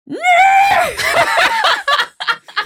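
high shrieking witch cackle; witch laugh. Shown as a demonstration. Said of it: "like, a witch laugh".

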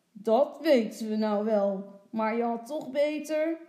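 loud, cross telling-off; grumbling father. A narrator's reading. Father joins in scolding Lotje, grumbling. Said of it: "dan moppert vader ook".

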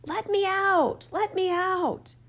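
high, drawn-out plea from someone shut away; muffled calling voice. A narrator's reading. Trapped creature calls for release from underground, high muffled cry. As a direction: call out slowly in a high, trapped voice. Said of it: "calling out with a truly muffled sound".

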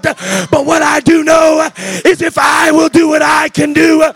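loud, high, slowing shouted preaching; preaching at full force. Acted. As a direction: hammer each phrase to the crowd. Preacher shouting over music.

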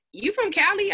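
high, rising imitation of classmates; retold surprised question. Classmates quizzing her on where she's from, retold as surprised question.

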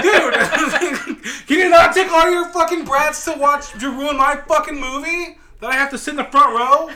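loud exasperated rant, laughing through the outrage; outraged rant. Real, not acted. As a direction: blow up at the parents, half laughing. Ranting about kids at the movie.

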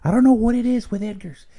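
normal talking voice

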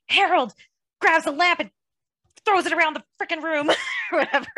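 high, loud, rushed mock panic; frantic. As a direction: act out the freak-out. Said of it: "He's like".

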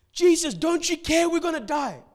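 high, loud mock panic; panicked disciples. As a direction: cry out like men sure they will drown.

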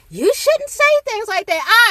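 high, preachy mock voice; mocking guru.

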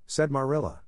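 usual speaking voice

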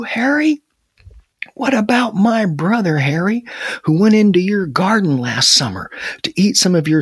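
high, scornful woodchuck grievance; indignant calling. A narrator's reading. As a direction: call out accusingly, pitch swooping up on the questions. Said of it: "the woodchuck called".

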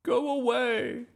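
high, loud, drawn-out order; shouted. A narrator's reading. Miss Boot hiding in the vestry, shouting. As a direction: shriek them away. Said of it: "shouted Miss Boot".

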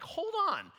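high, emphatic challenge to oneself; convicted. Retold or imitated. Man questions faith that works only in church, convicted. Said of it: "I got really convicted".

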